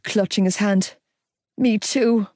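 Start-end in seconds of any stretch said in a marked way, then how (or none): none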